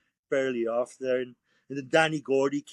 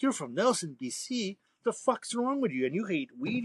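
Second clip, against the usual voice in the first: incredulous disbelief; 8 semitones higher than usual. Retold or imitated. Incredulous retort in bar story.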